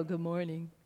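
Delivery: put-on a sleepy teen's gloomy Eeyore morning. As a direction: mope it out like a gloomy donkey. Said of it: "Eeyore from Winnie the Pooh".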